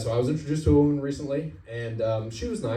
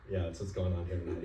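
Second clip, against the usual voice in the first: lower, softer sheepish repeat; meek echo; 4 semitones lower, 8 dB quieter than usual. Retold or imitated. Caught driver echoing the cop, meek and soft. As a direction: mumble it back, defeated.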